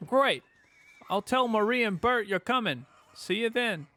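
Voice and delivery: loud, high mock Italian; bright-pitched, badly faked Italian accent; poor Italian accent. Player voicing a friend confirming supper plans, poor Italian accent. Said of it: "try to do a poor Italian accent".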